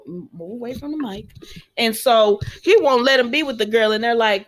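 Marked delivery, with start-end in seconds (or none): 0.1-1.3 quiet aside. Real, not acted. Aside to her child off-mic.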